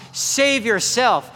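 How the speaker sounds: "Save yourself" drawn out slowly, high and loud; slow mocking taunt. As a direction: spit each word slowly as a dare. Crowd mocking Jesus on cross.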